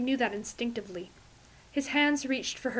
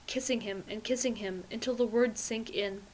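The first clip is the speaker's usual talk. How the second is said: ordinary delivery, nothing marked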